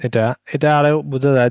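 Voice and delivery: usual speaking voice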